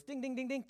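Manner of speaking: high, even repeated dinging; imitated quiz bell. Preacher signalling Peter's right answer, high bell imitation. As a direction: chime like a game-show bell.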